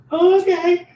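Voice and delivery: high startled oh okay. Retold or imitated. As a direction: react in stunned surprise. Startled reaction to the widow.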